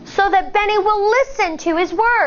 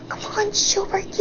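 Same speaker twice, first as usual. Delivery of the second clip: hushed cheering whisper; 4 semitones higher, 6 dB quieter than usual. Acted. Classmates whispering support from the side, hushed. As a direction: breathe the encouragement.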